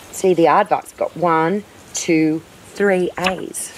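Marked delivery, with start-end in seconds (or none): none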